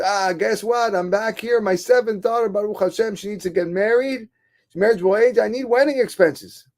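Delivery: high, eager rush of news; excited announcement. Acted. Father tells old man of seventh daughter's wedding, excited. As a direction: burst out happily, pitched well up. Happy man approaches his helper.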